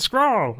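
"Squirrel" forced out high with a German slant. Retold or imitated. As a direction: blurt "Squirrel" high, German-accented, rolled into one syllable. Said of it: "then she went".

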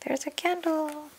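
high, quiet, lilting coo; soft delighted reveal. A narrator's reading. Reader revealing what's inside the pumpkin, gently delighted. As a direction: softly sing out the discovery.

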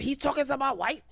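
high, fast indignant repeat; hammed-up jealous husband; jealous mock JoJo.

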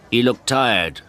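plain reading voice

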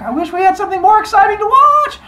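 very high, loud, fast yell; hammed-up cheering fan. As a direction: shout it over the crowd. Cheering crowd voiced in story.

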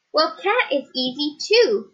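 usual speaking voice

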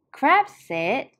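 ordinary delivery

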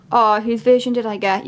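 ordinary delivery

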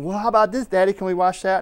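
put-on high, eager child's voice asking Daddy; a kid tugging at his dad in the video store; little boy begging. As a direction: play the small boy pleading for a video.

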